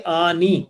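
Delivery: teacher modelling the ending آنی slowly; sound "aani". Shown as a demonstration. Teacher shows students the dual ending sound, clearly modelled. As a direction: say آنی with a long open "aa" then "nee". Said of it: "اور یہ sound ہوتی ہے".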